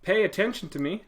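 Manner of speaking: loud, high call for attention; yelling at the computer. Pretend. Teacher yelling at the computer to pay attention. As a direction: shout to get the computer listening. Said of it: "I'm yelling".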